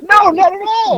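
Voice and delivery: squeaky high no, not at all; playfully protesting, voice jumping high; high mock denial. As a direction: pitch way up, protesting innocence.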